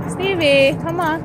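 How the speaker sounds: bright call of a name to a dog. Real, not acted. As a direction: call the dog over across the yard. Calling her dog over.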